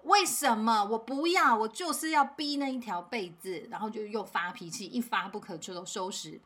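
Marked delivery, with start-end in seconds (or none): 0.0-3.7 imitated child's loud, higher protest; child tantrum. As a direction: stamp your foot like a stubborn kid. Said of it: "马上又发脾气了".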